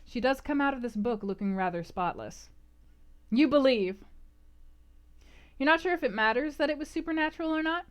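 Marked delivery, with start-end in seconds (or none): none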